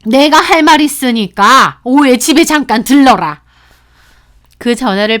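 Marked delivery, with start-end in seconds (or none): 0.0-3.4 angry mother-in-law, louder and far from the narrator's voice; 화난 시어머니. A narrator's reading. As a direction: order her over as an angry mother-in-law. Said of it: "화난 목소리로 집에 좀 오라고". (화난 목소리로 크게 호출하는 시어머니)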